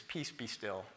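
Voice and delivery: softly, a calm order to the storm; hushed command. Retold or imitated. Preacher voicing Jesus telling the wind to stop, hushed and calm. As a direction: speak peace low and quiet over the waves.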